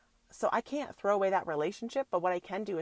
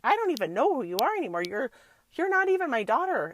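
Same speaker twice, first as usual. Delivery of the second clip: much louder and higher, fast and fed up; exasperated yell; 6 semitones higher, 9 dB louder, faster than usual. Retold or imitated. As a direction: blow up at the teen. Mom retells snapping at daughter.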